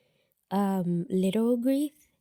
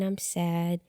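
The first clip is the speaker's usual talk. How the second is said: usual speaking voice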